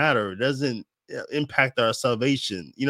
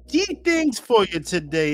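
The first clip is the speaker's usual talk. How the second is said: Jamaican accent; 8 semitones higher, 3 dB louder than usual. Shown as a demonstration. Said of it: "in the Jamaican voice".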